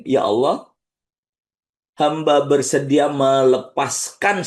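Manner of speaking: slow, drawn-out forgiving prayer; calm prayer of forgiveness; guided release prayer. Acted. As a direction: let go slowly with each phrase.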